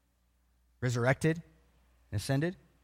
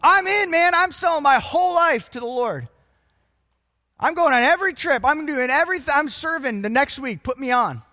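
high, loud, rushing pledges of commitment; fired-up new convert; 7 semitones higher, 5 dB louder than usual. Acted. New believer pledging everything at a conference, excited and high. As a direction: gush like a conference convert, all in. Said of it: "they're crying. They're like".

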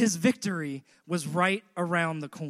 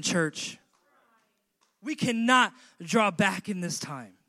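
ordinary talk

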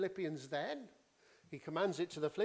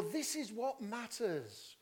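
slow, raised pitch, weighty delivery; deliberate and insistent, pitched up; emphatic declaration; 5 semitones higher than usual. Shown as a demonstration. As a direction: drive home "this is what matters". Voices an emphatic suggested translation.

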